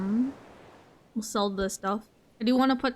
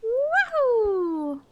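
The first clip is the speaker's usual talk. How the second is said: high falling woohoo; excited cheer; 11 semitones higher than usual. Real, not acted. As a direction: whoop with joy. Cheering a catch.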